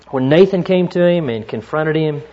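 ordinary talk, nothing marked

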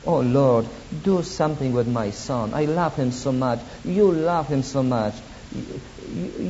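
normal talking voice

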